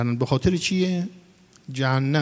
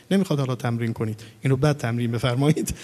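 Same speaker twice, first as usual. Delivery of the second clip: ordinary talk, nothing marked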